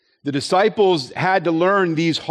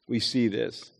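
much softer, dropped echo; soft repeat; 4 semitones lower, 9 dB quieter than usual. Shown as a demonstration. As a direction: lower and hushed, as an aside.

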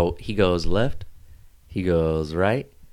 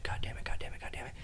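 hushed panicked whisper under the breath; whispered cursing; 15 dB quieter than usual. Retold or imitated. Pulled over driver cursing to himself, whispered. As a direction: mutter it so the cop won't hear.

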